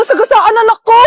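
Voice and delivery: high, loud panicked outburst; panicked cry. Acted. Mother panicking at news her daughter was hit. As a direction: blurt it out in panic.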